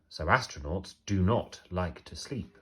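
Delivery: ordinary delivery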